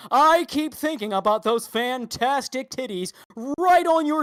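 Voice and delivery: loud, slow, high anchor voice; newscaster dirty talk. Retold or imitated. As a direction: shout it like a sleazy news host. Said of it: "dirty talking".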